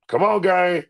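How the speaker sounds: ordinary delivery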